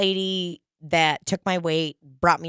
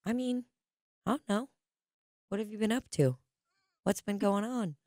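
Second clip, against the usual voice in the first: soft, higher-pitched laid-back doctor impression; chill doctor; 4 semitones higher, 7 dB quieter than usual. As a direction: imitate her casual shrug, quietly, with pauses. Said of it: "she's just chill as could be".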